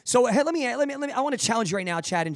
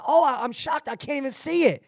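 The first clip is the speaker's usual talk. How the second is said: higher-pitched, put-on astonishment; mock shock; 6 semitones higher than usual. Dad teasing daughter over her dirty fish bowl, mock shocked. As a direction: play up the teasing disbelief.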